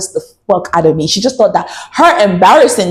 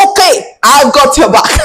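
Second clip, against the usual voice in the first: eager agreement; 6 semitones higher, 3 dB louder than usual. Retold or imitated. Playful answer to viewers' request.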